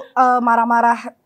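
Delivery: ordinary talk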